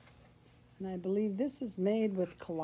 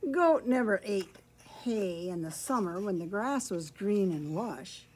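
ordinary delivery, nothing marked

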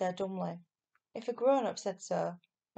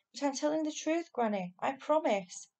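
earnest protest; 6 semitones higher, 3 dB louder than usual. A narrator's reading. Boy insists he is believed.

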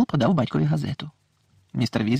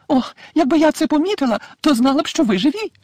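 high, worried motherly voice; a fretting mother, breathless with worry; anxious mother; 14 semitones higher, 5 dB louder than usual. A narrator's reading. Mrs Weasley runs to her husband, high and anxious.

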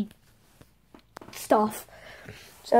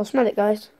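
ordinary delivery

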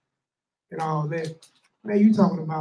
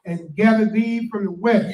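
scripture reading; 4 semitones higher than usual. Acted. Reads scripture aloud.

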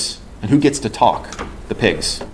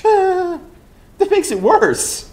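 laughing retort; much slower than usual. Real, not acted.

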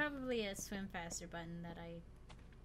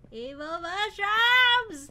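shout; 13 semitones higher, 19 dB louder, much slower than usual. Real, not acted. Spotting enemies, shouting.

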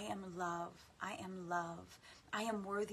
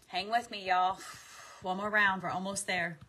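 loud encouragement; 8 dB louder than usual. Acted. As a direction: call out louder to keep them going. Encouraging the viewers.

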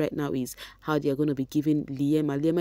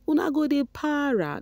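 higher, teasing Pidgin taunt; mock taunt; 11 semitones higher than usual. Mocking rival fans in Pidgin, higher voice.

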